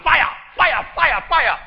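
high, loud shouted cry of fire; calling out fire. Retold or imitated. Woman in church crying out in tongues, high loud shout of fire. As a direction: belt out fire like a woman swept up in worship. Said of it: "I ball out".

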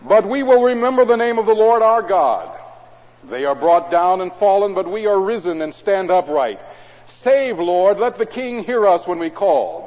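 solemn scripture proclamation; psalm reading. Acted. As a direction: lift the psalm to the congregation. Reads psalm aloud.

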